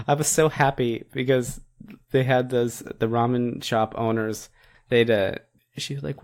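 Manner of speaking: ordinary talk, nothing marked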